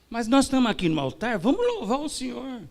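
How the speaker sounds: pastor insisting on praise. Retold or imitated. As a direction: brush it aside and keep the praise going.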